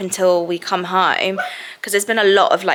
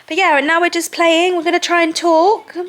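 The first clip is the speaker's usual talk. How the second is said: put-on baby talk; 9 semitones higher than usual. Mum talking to her baby.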